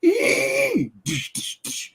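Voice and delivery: high mock taunting noise; shrill-free imitated taunt sound; mocking noise imitation.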